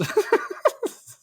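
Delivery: high breathy burst of laughter. Real, not acted. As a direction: crack up at the line. Laughing at the quote.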